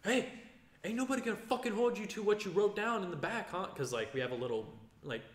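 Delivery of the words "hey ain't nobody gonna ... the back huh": higher, gruff yelling coach; imitating coach Adam. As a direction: bark it like a tough coach taunting a tired fighter. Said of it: "Adam just goes".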